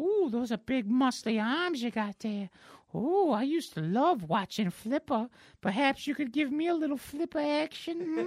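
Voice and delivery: high, cooing mock seduction; hammed-up coquettish superheroine; flirting. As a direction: flatter them like a cartoon flirt. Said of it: "I'm flirting, honey".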